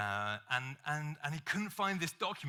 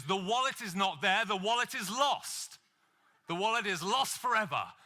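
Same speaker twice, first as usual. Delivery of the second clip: mock despair; 5 semitones higher, 4 dB louder than usual. Man searching for his wallet.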